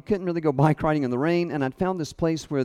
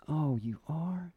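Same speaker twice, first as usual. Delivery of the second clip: soft, slow, gentle surprise; a hint of a gentle friend; 9 dB quieter, slower than usual. Friend asks gently after a long run, soft and slow. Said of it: "he's so gentle".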